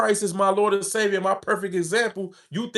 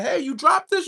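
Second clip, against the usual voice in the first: stranger's high friendly call; 9 semitones higher than usual. Retold or imitated. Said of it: "a total stranger be like".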